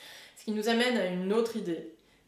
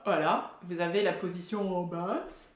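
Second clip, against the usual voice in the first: ordinary talk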